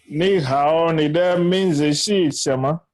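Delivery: ordinary talk, nothing marked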